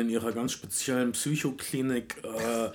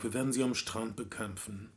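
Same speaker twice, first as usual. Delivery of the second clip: soft, understated sorrowful quote; imitating a weary statesman, voice dropped quiet; wise sadness; 3 semitones lower, 8 dB quieter than usual. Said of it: "diese weise Traurigkeit".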